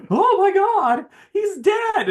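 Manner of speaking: very high, hammed-up shriek of shock; comic panicked girl impression; mock horror. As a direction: wail it in fake alarm, high-pitched. Retelling Kitty's shock at death.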